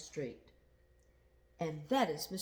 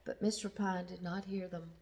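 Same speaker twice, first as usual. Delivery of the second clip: plain reading voice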